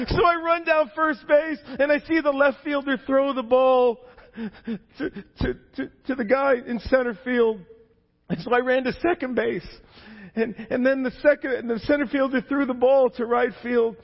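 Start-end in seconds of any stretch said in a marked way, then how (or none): none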